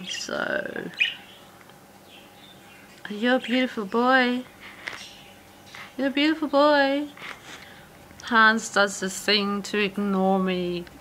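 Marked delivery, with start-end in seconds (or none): 3.1-7.1 high, sweet praise for a bird; pet talk voice. Real, not acted. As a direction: coo at a budgie. Praising the budgie.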